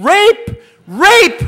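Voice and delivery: high, loud cry of alarm; screaming. Retold or imitated. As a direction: imitate a woman screaming for help. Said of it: "she's screaming".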